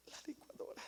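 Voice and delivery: whispered, mournful; wife whispering about the broken blender; sad whisper. Acted. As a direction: breathe it out like grieving. Wife answers sadly, whispering.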